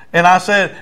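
usual speaking voice

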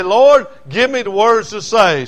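slow, loud, high pleading prayer; imitating preacher's prayer. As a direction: pray it out slowly like the old preacher. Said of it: "he'd pray and he'd say".

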